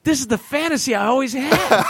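giddy drugged patient; stoned delight. Retold or imitated. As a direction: gush it, floating on painkillers. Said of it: "'cause I'm stoned".

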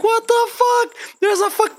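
high-pitched mock astonishment; stunned disbelief. Driver retelling sight of a boat on freeway, high stunned disbelief. Retold shock at seeing the boat.

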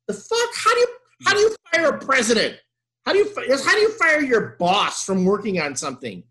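high-pitched, exasperated disbelief; incredulous outburst. Retold or imitated. Fan reacting to a firing rumor, incredulous. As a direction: sputter it out, pitch jumping up.

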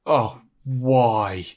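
ordinary delivery

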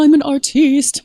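high, affected mock-artist voice; artsy-fartsy actor. Actor mocking artsy-fartsy colleagues, affected and pretentious. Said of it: "like, artsy-fartsy".

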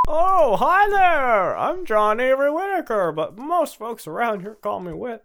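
high, stretched impression of an old radio character; Wit. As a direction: pitch it up high and draw it out. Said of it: "It's better than mine".

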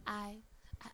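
hushed, hesitant imitation of an admission; mock confession. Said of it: "And he said".

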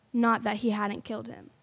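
ordinary delivery

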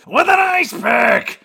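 high, loud, slow dramatic shout; Michael Douglas impression. Detective revealing murder weapon, loud mock shout. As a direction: announce it like a ham cop. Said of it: "Michael Douglas says".

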